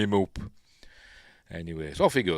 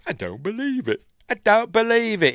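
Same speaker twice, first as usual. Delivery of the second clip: high-pitched mock Cockney exclamation, growing louder; Cockney accent; 13 semitones higher, 8 dB louder than usual. Imitating the exasperated film driver, high Cockney accent. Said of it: "he says in the Cockney accent".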